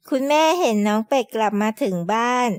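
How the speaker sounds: ordinary delivery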